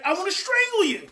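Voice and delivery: high, quick mock exasperation; exasperated parent. Parent fed up with child, mock exasperated. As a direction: burst out like a parent at wit's end. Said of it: "but you're like".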